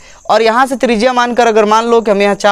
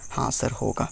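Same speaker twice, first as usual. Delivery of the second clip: whispered, meek pupil answer; mock student reply; 9 semitones lower, 15 dB quieter than usual. Teacher playing a timid pupil, a whispered yes.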